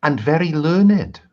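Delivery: prim royal lady, lifted voice; cartoon princess. Princess character at the feast.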